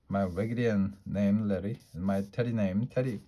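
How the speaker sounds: ordinary delivery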